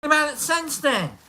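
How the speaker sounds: playful high-pitched scolding; joking scold. Retold or imitated. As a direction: tease him to knock it off. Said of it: "I'm like".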